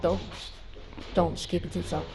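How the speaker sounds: ordinary talk, nothing marked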